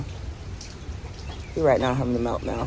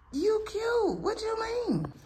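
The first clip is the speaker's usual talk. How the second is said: much higher sing-song pet voice; doggy baby talk; 7 dB quieter, much slower than usual. Acted. Owner praising her dressed-up dog, high cooing baby talk.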